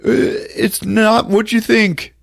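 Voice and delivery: high, loud hammed-up squeaky voice; shrill mock husband. Husband squealing back at his wife, hammed up. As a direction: squeal it like a caught cheater. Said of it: "And he goes".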